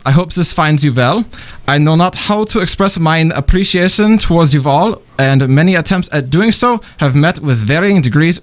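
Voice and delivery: formal email read in a German accent; mannered, courtly German-accented reading. Shown as a demonstration. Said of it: "in my German accent".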